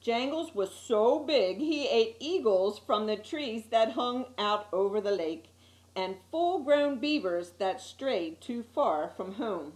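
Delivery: ordinary delivery, nothing marked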